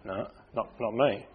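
very soft, low, hesitant murmur; mock denial. Preacher mimicking his congregation's silent reaction, mock hushed denial. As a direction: imitate a hushed, halting denial. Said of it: "You're all just looking at me going".

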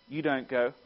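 ordinary talk

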